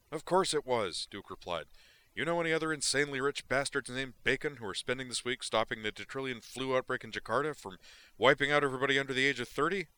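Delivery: big, wired, careless delivery; Duke's voice. Acted. Duke brushes off Zoner's question, loud and wired.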